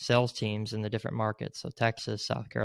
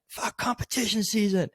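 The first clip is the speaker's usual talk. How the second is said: half-hearted shout of a swear; pitched-up imitation of yelling on a call; retold scream; 9 semitones higher, 4 dB quieter than usual. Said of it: "I just had to just scream it".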